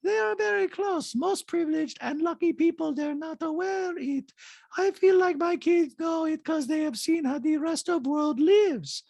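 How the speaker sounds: put-on very high-pitched accented caricature; squeaky falsetto mimicry of a reality star; Diana impression. Host mimics reality star in recap, high-pitched caricature. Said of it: "Diana, in a talking head, goes".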